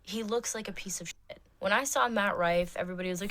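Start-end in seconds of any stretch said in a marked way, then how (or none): none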